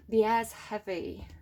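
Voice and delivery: ordinary delivery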